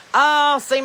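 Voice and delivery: usual speaking voice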